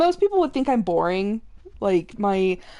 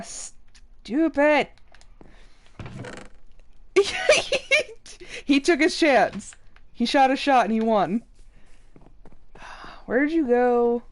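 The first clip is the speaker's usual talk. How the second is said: ordinary delivery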